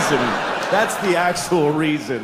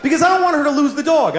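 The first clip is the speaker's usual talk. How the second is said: emphatic insistence; 9 semitones higher than usual. Real, not acted. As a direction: insist it through a laugh. Insisting on a dark wish.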